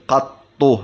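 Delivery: قطو as qat-tu, split on the ط; doubled ط. Shown as a demonstration. Teacher giving the standard form, قطو with doubled ط. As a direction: hold the ط in قطو before the final u. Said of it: "اللغة الفصحى هي التجديد".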